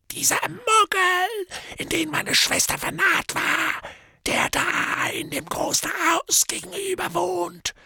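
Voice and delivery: high, cracked mad voice; a deranged drunken hermit; crazed drunk. Acted. Morphin's voice.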